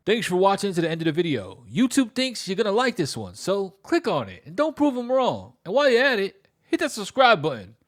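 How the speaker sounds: loud, pumped YouTube sign-off; big loud call to click and subscribe; hyped outro. Acted. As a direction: amp it up for the end screen. End-screen outro.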